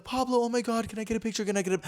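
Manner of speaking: high, squealing fan voices; excited fans. Retold or imitated. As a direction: play breathless young fans begging for a photo. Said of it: "they're like".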